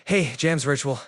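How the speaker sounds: usual speaking voice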